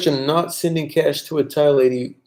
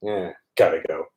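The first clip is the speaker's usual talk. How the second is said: lazy unbothered reply; 5 semitones lower, 6 dB quieter than usual. Retold or imitated. Relaxed Thais shrugging off the rushed foreigner, low and lazy. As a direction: play it totally unbothered. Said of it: "They're like".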